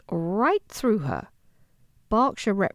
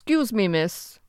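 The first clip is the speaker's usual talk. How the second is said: deep male rumble. A narrator's reading. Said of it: "a very deep, very male voice rumbled".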